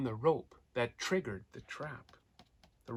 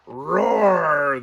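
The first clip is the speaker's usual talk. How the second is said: loud, higher roar calling for help; lion calling out; 4 semitones higher, 11 dB louder than usual. A narrator's reading. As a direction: roar as if calling across the grassland. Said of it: "the lion continued to call out".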